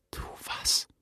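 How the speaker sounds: voiceless, very soft whisper. A narrator's reading. Voice in the father's head urging action, whispered. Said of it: "flüsterte eine Stimme in seinem Kopf".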